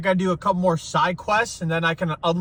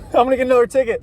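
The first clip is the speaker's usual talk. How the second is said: real laugh, higher and quicker; high, quick laughing nervous joke; 8 semitones higher, 6 dB louder, faster than usual. Real, not acted. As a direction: giggle it out fast at yourself. Laughing after cop stop while driving.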